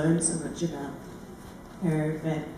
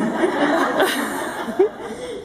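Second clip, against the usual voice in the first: amused laugh; 10 semitones higher than usual. Real, not acted. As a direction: laugh it off lightly. Laughing at own song choice.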